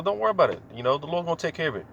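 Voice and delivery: calm her panic gently; husband soothing his panicking wife; reassuring wife. Retold or imitated. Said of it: "And I just tell her".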